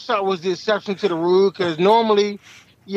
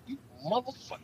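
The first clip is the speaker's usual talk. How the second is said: muttered curse; 5 semitones higher, 15 dB quieter, faster than usual. Retold or imitated. Cop's smile seen.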